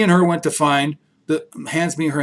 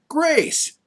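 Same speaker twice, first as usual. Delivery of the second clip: surprise reveal; 12 semitones higher than usual. A narrator's reading. Reveal of friend's name.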